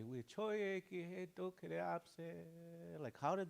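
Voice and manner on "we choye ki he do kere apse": slow, quiet sentence, each syllable placed; "We choye ki he do kere apse" said slowly and softly in a foreign tongue; foreign phrase. Shown as a demonstration. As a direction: read the line out slow and hushed like a model sentence. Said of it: "You can say".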